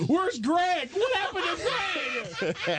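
high, hammed-up panicked yell; mock frantic shouting. Townsfolk searching for missing Greg, mock hysterical shout. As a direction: call out desperately, drawing out the end.

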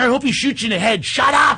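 high, quick mock snap; imitating her own rude comeback; sarcastic retort. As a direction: fire back at the talker. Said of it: "Yeah, he's there".

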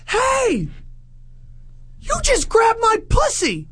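very high-pitched shriek without real volume; squealing mock accusation; mock woman's yell. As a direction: imitate an outraged woman calling out, way up high. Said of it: "the chick yells".

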